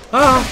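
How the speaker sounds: sharp high yelp; a sudden cry of alarm; startled yelp. Real, not acted. Jump falls short.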